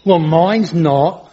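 slow, halting fake English accent; unique changing accent. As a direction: wobble through an invented English village accent. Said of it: "it has this unique accent, which keeps changing".

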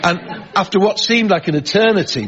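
ordinary talk, nothing marked

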